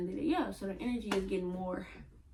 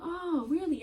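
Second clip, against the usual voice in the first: mock surprise; 11 semitones higher, 4 dB louder than usual. Acting out her own retort.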